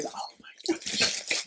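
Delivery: breathless whisper of delight; whispered oh my gosh. Real, not acted. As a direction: whisper it as if the gift is too cute to say aloud. Opening a gift, whispered delight.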